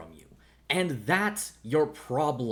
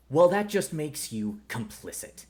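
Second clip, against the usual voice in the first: ordinary delivery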